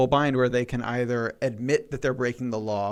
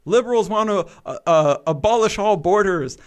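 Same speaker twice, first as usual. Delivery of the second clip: mocking conservatives; 6 semitones higher, 5 dB louder than usual. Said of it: "like, conservatives".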